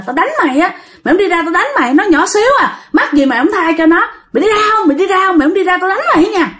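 high, quick, threatening rebuke; scolding old man. Acted. Old man threatens the ghost to leave her alone, high and scolding. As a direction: demand that he get out, fast and high. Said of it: "Thì ông già đó ông mới nói".

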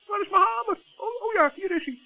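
normal talking voice

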